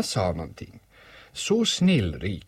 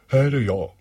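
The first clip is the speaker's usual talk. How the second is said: raised, louder thick-voiced answer; 5 semitones higher, 7 dB louder than usual. Bear answers from behind the boy, thick voice. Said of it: "sa en tjock röst bakom honom".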